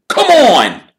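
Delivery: high, loud team captain calling; eager captain. Acted. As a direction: hurry him out of bed loudly. Wood urging Harry.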